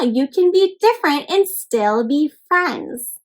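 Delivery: plain reading voice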